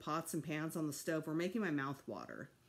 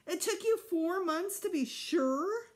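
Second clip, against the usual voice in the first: much higher, louder, slow indignant question; outraged boy; 11 semitones higher, 5 dB louder, slower than usual. A narrator's reading. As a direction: son can't believe parents waited, high and loud.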